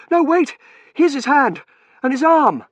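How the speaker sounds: high, loud excited cries; urgent discovery. A narrator's reading. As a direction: shout out each find in the rubble. Finds buried man's hand.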